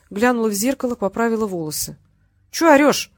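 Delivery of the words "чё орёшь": high, loud, breezy retort; cheerful. A narrator's reading. Daughter answers her yelling drunk father, cheerful and unbothered. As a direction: toss it back cheerfully. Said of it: "весело спросила она".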